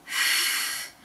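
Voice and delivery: le son f, soufflé sans voix. Shown as a demonstration. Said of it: "on entendait le son". (le son « f » soufflé)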